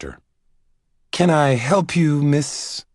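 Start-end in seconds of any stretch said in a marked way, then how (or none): 1.1-2.8 higher, slow eager librarian; Caleb's voice. Acted. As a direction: Caleb greets a visitor at his desk, eager. Caleb voiced greeting Annabelle.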